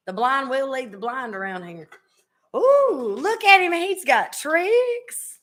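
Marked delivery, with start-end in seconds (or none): 2.5-5.4 high, drawn-out teasing "ooh"; playfully over-impressed; mock amazement. Real, not acted. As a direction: tease him, much higher, stretching the last word. Teases guest showing off a trick.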